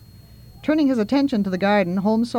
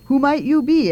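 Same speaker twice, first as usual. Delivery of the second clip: indignant question; 6 semitones higher, 5 dB louder than usual. Acted. Holmes asks in indignation.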